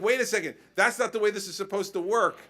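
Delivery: hammed-up constituent; mock constituent. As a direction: play the indignant voter laying out the rules. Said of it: "They're like".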